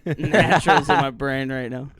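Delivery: loud, quick words through laughter; laughing. Real, not acted. Speller explains the giggles to teammate, laughing. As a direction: crack up while explaining yourself. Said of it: "I'm laughing too".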